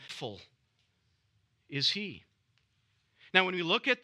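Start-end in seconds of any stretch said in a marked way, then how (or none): 0.1-2.2 Faithful set apart, then a pause before is he; emphasis on first word. Shown as a demonstration. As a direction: let Faithful hang alone before is he. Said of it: "putting all the emphasis on this first term".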